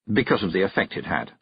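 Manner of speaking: usual speaking voice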